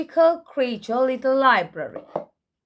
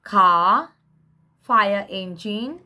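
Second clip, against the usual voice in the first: ordinary delivery, nothing marked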